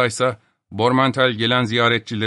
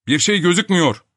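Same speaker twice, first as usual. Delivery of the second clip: high, raised, alarmed cry; frightened shout; 8 semitones higher, 5 dB louder than usual. A narrator's reading. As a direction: call out in mild panic. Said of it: "diye korkmuş bir vaziyette bağırdı". (korkuyla bağırarak)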